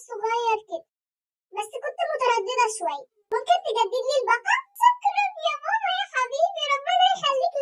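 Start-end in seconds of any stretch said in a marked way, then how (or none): 4.8-7.5 sweet thanks. Acted. Daughter sweet-talks dad.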